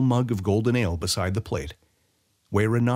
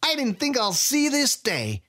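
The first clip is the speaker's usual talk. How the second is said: much higher, slower wiry rogue voice; gloating thief greeting an old rival; gleeful Sai. A narrator's reading.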